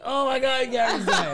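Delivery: high, loud panicked cry with a laugh; panicked shout. Retold or imitated. Retelling her panic as Gary collapsed, high laughing shriek.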